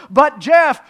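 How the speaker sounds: high, very loud protest; worried objector. Acted. Listener objecting to preacher in loud, high alarm. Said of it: "you may say".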